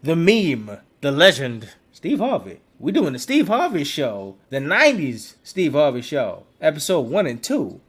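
loud, punched, building introduction; big hype-up host delivery; show announcer. Real, not acted. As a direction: land each phrase with emphatic pauses. Grand introduction of the show topic.

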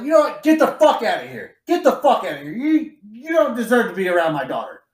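playing a father throwing the boys out; mock furious dad sending the dates away; mock angry dad. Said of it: "He's like".

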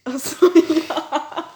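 slow, laughing through the words. Real, not acted. Laughing as she gets the LOL joke. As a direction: laugh it out as it dawns.

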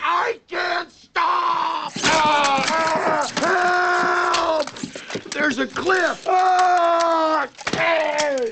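high, drawn-out panicked yelling; mock panic screams. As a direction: ham up losing the brakes near a cliff. Brakes fail, cliff ahead.

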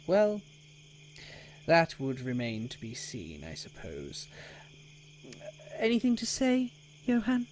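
officious, lightly condescending; pompous townmaster. Acted. Townmaster asks the prisoner for last words, pompous. Voices Albrecht questioning prisoner.

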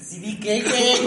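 high, lilting Irapuato question; Irapuato sing-song. Shown as a demonstration. Friend quotes Irapuato slang, high sing-song. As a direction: lift it up like an Irapuato local. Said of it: "Así como de".

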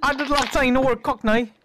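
high-pitched silly Cockney; mock Cockney. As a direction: keep up the daft fake Cockney. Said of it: "And then K P deals".

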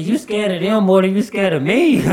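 high, loud granny challenge; imitated indignant outburst; menacing granny. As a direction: challenge him as an outraged grandmother. Granny threatening him.

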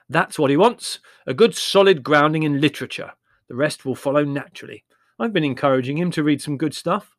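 loud, emphatic brother; with conviction. Acted. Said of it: "said Larry with conviction".

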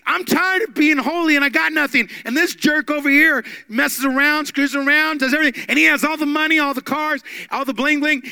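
loud, high griping; mock angry complaint. Asaph complaining to God, loud mock rant. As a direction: rant like a fed-up believer. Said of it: "Asaph was saying this".

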